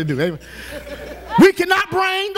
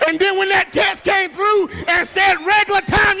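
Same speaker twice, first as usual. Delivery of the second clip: normal talking voice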